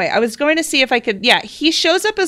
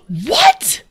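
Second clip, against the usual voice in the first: much higher, breaking into squeals; real squealing excitement; 3 dB louder, much slower than usual. Real, not acted. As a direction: shriek with disbelieving joy. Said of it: "way more excited than I need to be".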